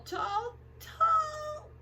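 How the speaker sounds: excited stretch; stretching tall. Acted. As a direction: reach way up with the voice.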